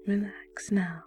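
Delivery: usual speaking voice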